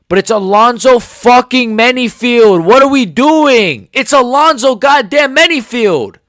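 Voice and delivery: real rage at a weak fighter; furious. Real, not acted. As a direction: spit out each name in outrage. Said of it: "I'm so angry".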